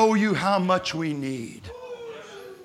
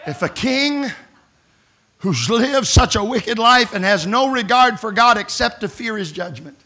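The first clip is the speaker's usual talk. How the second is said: ordinary delivery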